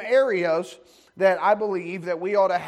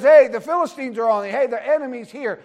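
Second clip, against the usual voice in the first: louder, higher warning cry; mock alarm call; 5 semitones higher, 7 dB louder than usual. Delilah crying out to Samson in mock alarm. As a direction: call out the enemy is here. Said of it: "And then she says".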